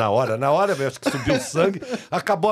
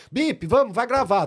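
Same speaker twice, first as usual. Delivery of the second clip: lightly voiced production girl, higher pitch; production girl calling; 7 semitones higher than usual. Production girl fetching the guests from the bar, calling out. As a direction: call them in to record, pitched up.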